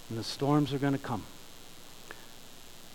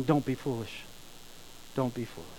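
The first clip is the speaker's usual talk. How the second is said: quiet, earnest plea, softer; soft pleading warning; 3 semitones lower, 9 dB quieter than usual. Retold or imitated. Jesus pleading with his listeners, soft and earnest. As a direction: gently beg them not to be foolish.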